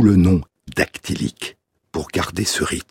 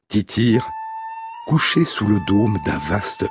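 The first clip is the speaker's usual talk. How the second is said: reading Virgil aloud in French verse; poem reading. Acted. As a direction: recite it gently like pastoral poetry. Reads second translation over music.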